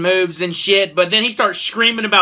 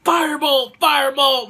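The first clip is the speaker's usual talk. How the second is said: mock scream; 7 semitones higher than usual. Reviewer imitates the hero casting inside the Minotaur, mock scream. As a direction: mimic a screamed spell without full volume. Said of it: "starts screaming".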